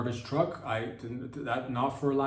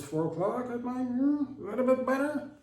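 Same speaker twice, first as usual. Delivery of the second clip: much higher, sing-song coy offer; coy sweet voice; 12 semitones higher than usual. Acted. Comedian coyly proposes a four o'clock date. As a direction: play it cute and flirty.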